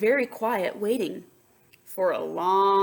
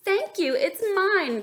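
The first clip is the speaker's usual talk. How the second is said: high, louder duck voice; proud little duck claiming it; 10 semitones higher, 5 dB louder than usual. A narrator's reading. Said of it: "quacked Duck".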